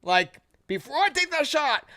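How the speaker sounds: put-on drunk challenge. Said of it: "a little twisted and just being like".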